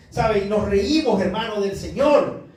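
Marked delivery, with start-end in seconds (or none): none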